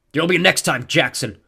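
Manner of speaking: loud, high vow of revenge; parting threat. A narrator's reading. Defeated god's parting threat.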